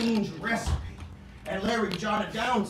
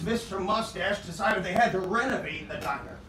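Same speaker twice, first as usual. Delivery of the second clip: ordinary delivery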